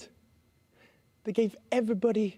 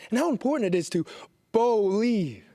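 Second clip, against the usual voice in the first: usual speaking voice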